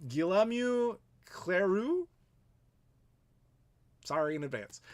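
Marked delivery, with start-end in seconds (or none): 0.1-2.1 Guillaume Cleroux drawn out slowly in a clumsy French accent, ending on a rise; bad French accent. Shown as a demonstration. Reading a French Canadian viewer's name, slow unsure French accent. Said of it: "my terrible French pronunciation".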